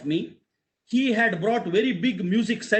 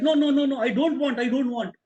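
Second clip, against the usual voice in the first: higher, urgent protest; insistent refusal; 6 semitones higher, faster than usual. Retold or imitated. Traveller refuses the student's money, protesting insistently. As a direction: wave it away in a rush of no's.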